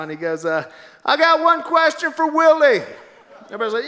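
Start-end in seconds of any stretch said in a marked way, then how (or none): 1.0-2.8 high, loud rally showman; Donald Trump. Retold or imitated. Trump puts Willie on the spot, loud impression. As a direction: call it out to a whole crowd.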